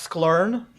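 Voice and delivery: "sklern" as one drawn-out word; joke pronunciation sklern. Shown as a demonstration. Quiz host teasing players on tool names, "sklern" read as a word. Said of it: "The fourth one is pronounced".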